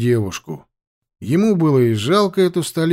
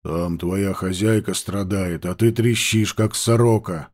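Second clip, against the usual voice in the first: scolding low voice; 5 semitones lower than usual. Acted. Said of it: "Aurang scolds".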